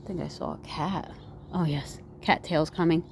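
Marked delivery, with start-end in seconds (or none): none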